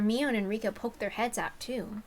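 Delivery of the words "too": usual speaking voice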